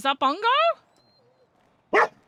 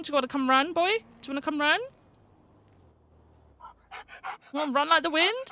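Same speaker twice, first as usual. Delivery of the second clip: higher, coaxing pet voice; talking to a dog; 5 semitones higher, faster than usual. Real, not acted. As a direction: sweet-talk the dog to come running.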